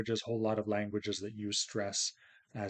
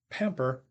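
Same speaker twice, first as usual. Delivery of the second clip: PAM-per, first syllable punched; stress on first syllable of pamper; 3 semitones higher than usual. Shown as a demonstration. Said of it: "has stress on the first syllable".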